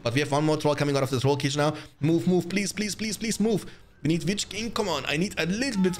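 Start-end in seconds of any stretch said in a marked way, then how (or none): 2.0-6.0 urgent pleading. Real, not acted. Urging units under attack.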